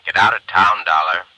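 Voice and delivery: loud, hard order to leave; Vickery barks at Dollar to get out; angry order. Acted. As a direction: run him out of town.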